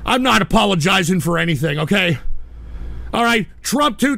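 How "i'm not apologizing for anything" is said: normal talking voice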